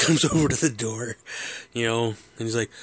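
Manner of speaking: ordinary talk, nothing marked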